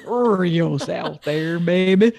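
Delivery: slow laughing Southern drawl; Southern accent. Acted. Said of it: "I just went real south".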